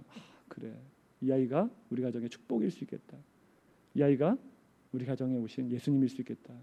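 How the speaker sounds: soft, low musing to oneself; subdued acceptance. Retold or imitated. Pastor coming round to the elder's words, quietly accepting. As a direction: let it sink in, very quietly.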